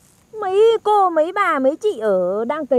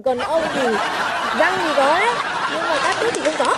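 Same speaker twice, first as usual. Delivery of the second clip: laughing tease; 3 semitones higher than usual. Real, not acted. Teases him while laughing.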